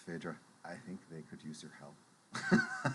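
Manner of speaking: quiet, low aside to a friend; leaning over to Phaedra, a quiet sly murmur. Acted. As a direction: nudge her with a sly murmur. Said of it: "I lean over to Phaedra, and I'm like".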